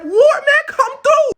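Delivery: very high, loud shout; mock battle challenge. King welcoming war with the British, high shouting. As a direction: holler a comic dare to fight.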